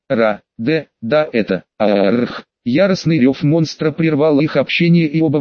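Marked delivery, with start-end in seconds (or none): 0.1-2.4 low, broken half-beast voice; inhuman voice. A narrator's reading. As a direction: force words out through a beast's throat. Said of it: "высоким нечеловеческим голосом".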